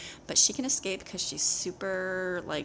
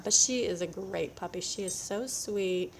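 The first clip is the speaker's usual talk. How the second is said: normal talking voice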